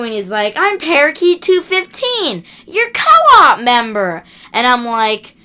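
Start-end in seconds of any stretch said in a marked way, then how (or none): 0.6-4.2 higher, slow, smug impostor voice; slow, high, fake-friendly Minnesota-style stranger; mock hacker. Hacker poses as co-op member.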